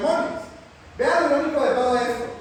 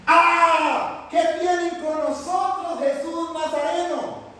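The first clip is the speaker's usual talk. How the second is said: demon shouting; 6 semitones higher, much slower than usual. Acted. Preacher voicing the unclean spirit crying out at Jesus, high slow shout. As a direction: wail it out like the demon caught by Jesus. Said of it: "que gritó".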